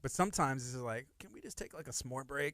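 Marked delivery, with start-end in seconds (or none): 1.2-2.5 very soft, half-whispered request; hushed plea. Retold or imitated. Crew member asking for a s'more break while filming, hushed plea. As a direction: ask it under your breath.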